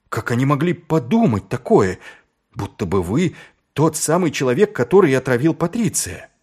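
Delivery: high, scandalized protest; indignant. Acted. Carrot outraged on Vimes's behalf.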